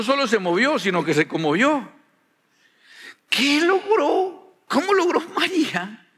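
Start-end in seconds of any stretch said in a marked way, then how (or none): 3.3-6.1 amazed wonder. Real, not acted. Preacher marvels at result.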